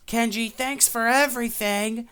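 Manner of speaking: high-pitched mock calling out; retold yelling. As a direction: call it out to someone far away, in imitation. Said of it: "they're, like, yelling at this guy".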